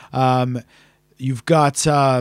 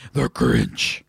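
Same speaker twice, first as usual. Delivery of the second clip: very low, put-on villain voice; Grinch. Host posing as the movie's star, deep character voice. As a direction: drop way down for a cartoon grump. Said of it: "Is that your Grinch voice?".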